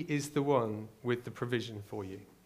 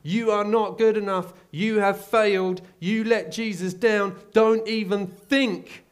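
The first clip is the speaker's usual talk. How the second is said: accusing rooster; 7 semitones higher, 9 dB louder than usual. Retold or imitated. Rooster accusing the failed disciple every morning, loud high crowing. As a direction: crow the accusations like a mocking rooster. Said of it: "a rooster that every morning ... is gonna be going".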